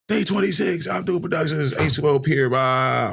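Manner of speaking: high-pitched event plug tailing into "blah"; mock promo announcement. Plugging the club night to the crowd, mock announcer. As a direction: rattle off the flyer like a promoter.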